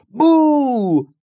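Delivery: high, loud, drawn-out boo; yelled boo. A narrator's reading. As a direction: jump out and startle them. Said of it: "Out jumped Etta Betta and yelled".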